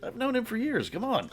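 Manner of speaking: high, breezy mock of an offhand friend; casual friend. Sophia shrugging off the news.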